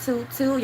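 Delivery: high, held toot-toot; sing-out toot toot, pitched high; mock whistle. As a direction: imitate a whistle being blown. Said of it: "I'm a whistleblower".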